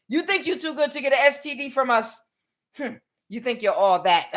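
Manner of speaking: high mock West African challenge; attempted Cameroonian accent. West African gaslighting an Empress, attempted Cameroonian accent. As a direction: scoff in a put-on Cameroonian accent. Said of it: "I can't do the accents tonight".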